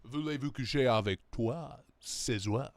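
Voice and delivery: low, lightly hammed French accent; mock French. Mocking the donation voice, mild French accent. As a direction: croon it like a cheesy Frenchman. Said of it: "Didn't know Trump was French".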